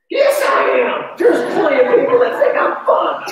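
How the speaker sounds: loud, high mock-defensive yell with a laugh; defensive shout. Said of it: "What do you say to that?".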